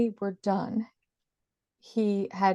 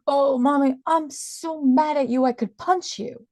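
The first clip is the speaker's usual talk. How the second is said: mock angry child's voice, higher and louder; a four-year-old fuming at mommy; angry little boy; 8 semitones higher, 4 dB louder than usual. Son angry, voiced by mother.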